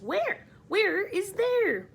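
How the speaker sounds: high, loud, slow baffled ask; bewildered question. Acted. Friends ask grasshopper where they are, high and loud. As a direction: demand to know where.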